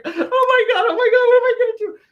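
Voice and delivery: very high, loud, laughing panic; panicked waitress in tears. Retold or imitated. Tearful waitress not knowing what to do, very high and panicky. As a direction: wail in mock despair. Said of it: "She's like".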